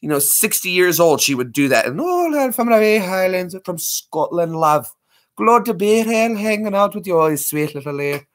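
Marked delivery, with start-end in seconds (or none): 2.0-8.2 light mock Scottish accent, higher at start; a playful grandma's Scottish accent. Grandma fooling airport people, a light Scottish accent. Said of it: "do Scottish voices in airports".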